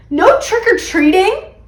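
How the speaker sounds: high, slow, loud disbelief; incredulous cry; dismayed question. A narrator's reading. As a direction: draw it out as a horrified child. Child dismayed at bad news.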